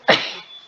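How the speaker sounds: sharp real cough; coughing fit. Real, not acted. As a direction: cough hard mid-sentence. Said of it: "Sorry I'm coughing so much".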